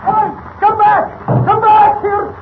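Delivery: high, drawn-out cries after someone leaving; panicked old bridge keeper shouting after the car; desperate calling out. Acted. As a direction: yell for them to come back, slow and pleading. Tied-up bridge keeper calls after them.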